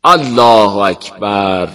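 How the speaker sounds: very slow, drawn-out Allahu akbar; sheikh's takbir. A narrator's reading. Sheikh calling takbir in the mosque, slow proclamation. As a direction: proclaim each takbir slowly and solemnly. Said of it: "با سه تکبیر شیخ احمد".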